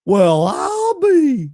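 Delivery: Gomer Pyle impression. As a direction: stretch it out wide-eyed like Gomer. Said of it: "that's my best Gomer Pyle voice".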